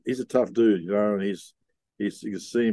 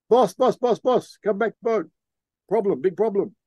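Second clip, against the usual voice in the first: urgent call; 9 semitones higher than usual. Retold or imitated. Crewman radios the captain to come back, urgent high call. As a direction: call the boss back in a rising panic.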